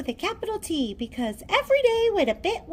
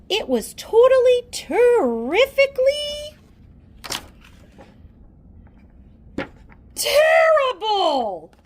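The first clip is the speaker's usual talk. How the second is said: building to a shout; 8 semitones higher, 5 dB louder than usual. A narrator's reading. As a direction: build it up then bellow the last word. Narrator builds to exclamation.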